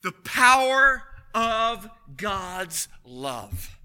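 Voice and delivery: slow, loud, weighty declaration; booming pulpit pronouncement; slow proclamation. Acted. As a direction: let each word land heavily. Preacher proclaims key phrase.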